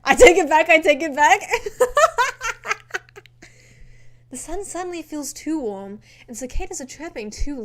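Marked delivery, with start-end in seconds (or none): 0.0-0.7 loud. Real, not acted. Loud playful retraction. 0.7-1.4 laughing. Real, not acted. Repeated, laughing. 1.4-3.5 high loud burst of laughter. Real, not acted. Loud laugh after retraction.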